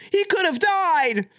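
very high, drawn-out hammed-up outrage; over-the-top fake distress; mock horrified alarm. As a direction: imitate a pearl-clutching tweeter, slow and high. Mocking overly serious alarmed tweets.

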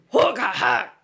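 short punchy haka cry; haka shout. Shown as a demonstration. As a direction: belt out a mock haka shout. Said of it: "that's more like".

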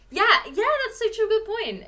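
high, loud eager yeah yeah; excited agreement. Real, not acted. Agreeing with her co-host, high excited agreement. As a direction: jump in thrilled at the idea.

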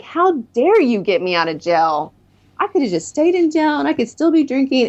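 mock-indignant protest, climbing in pitch; pissed off. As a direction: reenact being outraged at him. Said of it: "I was so pissed off at him".

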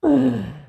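a sudden, high, loud laugh; high burst of laughter. Real, not acted. Laughing at his list of winter comforts. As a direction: crack up at your own joke.

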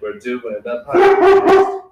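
high, loud, drawn-out call. Real, not acted. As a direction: holler it slowly to the visitor. Calling out to the visitor.

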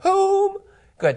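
ordinary talk, nothing marked

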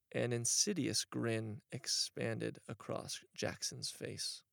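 usual speaking voice

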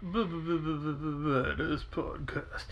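slow stuttered echo; stuttering echo effect. Pretend. Echoing the podcast title as effect.

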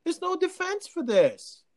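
high, incredulous insistence; exasperated protest. Real, not acted. Protest escalates on repeated line.

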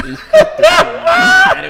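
carcajada aguda y fuerte al maldecir; maldición entre risas. Retold or imitated. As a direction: suelta la maldición alargándola, muerto de risa. Cuenta su rabia entre risas. (maldición a carcajadas)

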